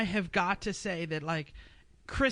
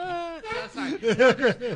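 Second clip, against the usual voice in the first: laughing; 8 semitones higher, 3 dB louder, much slower than usual. Real, not acted. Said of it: "laughing denial".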